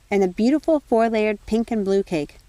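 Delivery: ordinary delivery, nothing marked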